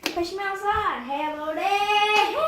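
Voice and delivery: "How about it" stretched into a singsong; catchphrase imitation with a long, sung-out ending; catchphrase demo. Shown as a demonstration. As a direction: draw out the last word of "How about it". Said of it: "It's like".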